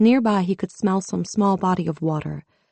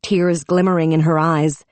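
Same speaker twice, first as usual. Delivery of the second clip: plain reading voice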